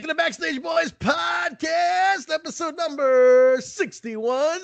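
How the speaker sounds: hammed-up podcast host intro; announcer intro. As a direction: boom out the show intro like a ring announcer.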